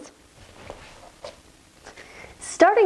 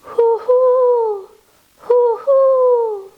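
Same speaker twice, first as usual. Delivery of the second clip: high, long hoots; owl hoot; 10 semitones higher, much slower than usual. A narrator's reading. Story reader voicing Owl, high long hoots. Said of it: "called Owl".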